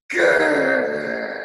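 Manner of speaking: long, very low growl; dinosaur growl. Acted. Rory the mad dinosaur ending his song, growling. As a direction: hold a loud, deep grrr. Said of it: "do you think he gurred?".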